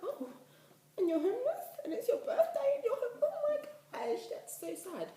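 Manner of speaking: high, loud wailing dismay, dropping on the last words; squealed, tearful-sounding pity; distraught sympathy. Retold or imitated. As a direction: react with over-the-top heartbroken disbelief. Retelling reaction to homeless boy's birthday.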